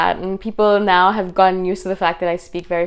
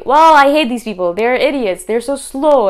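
louder, higher, hammed-up indignation; mock angry rant; 5 semitones higher, 6 dB louder than usual. Imagining lashing out at critical viewers, a mock furious rant. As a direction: play out the bitter reaction she chose not to have. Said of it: "easy for me to sit down and say".

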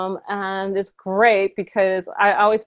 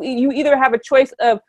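usual speaking voice

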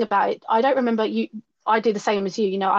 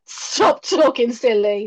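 dismissive parent; 2 semitones higher, 4 dB louder, much slower than usual. Retold or imitated. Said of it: "They'd be like".

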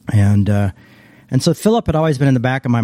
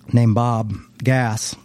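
usual speaking voice

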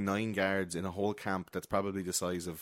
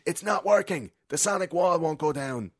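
high, loud mock panic; acted alarm; 8 semitones higher, 5 dB louder than usual. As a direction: imitate a hacker calling out that the plan is failing. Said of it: "Weiss then goes over and he's like".

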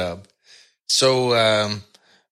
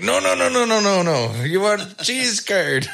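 imitating a flustered clerk; 12 semitones higher than usual.

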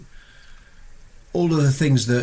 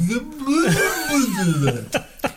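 high, drawn-out gibbering noise; high-pitched wordless gibber; star-struck babble; 13 semitones higher than usual. Pretend. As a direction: babble as if tongue-tied before your hero. Imitates tongue-tied star-struck babble.

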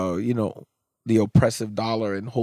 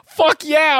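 excited cheer; 4 dB louder than usual. Real, not acted. Proud dad cheering daughter on.

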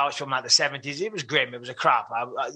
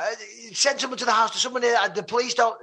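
high, stammering acted panic; frantic, pitched-up fragments; panicking; 8 semitones higher than usual. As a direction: blurt a broken plea for help. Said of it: "Anyway, I'm panicking".

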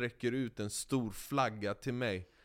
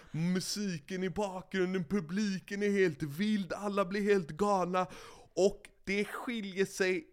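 excited; 8 semitones higher, 4 dB louder than usual. Acted. Climax of the winning ride.

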